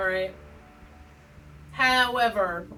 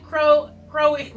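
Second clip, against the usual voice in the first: breaking up over the name, higher and louder; real laughing; 4 semitones higher, 6 dB louder than usual. Real, not acted. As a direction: try to say it, then crack up laughing. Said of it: "can't even say the name without laughing".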